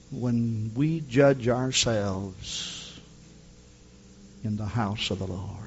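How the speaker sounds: very slow, whispered close of the thought; a reverent whisper to the congregation; hushed whisper. Real, not acted. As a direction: drop to a whisper for weight. Sermon falls to hush.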